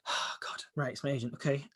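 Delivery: breathless whispered panic under the breath; whispered nerves before answering the agent's call; nervous whisper. Retold or imitated. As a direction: whisper it like you dread the phone ringing. Panicked self-talk on seeing agent call.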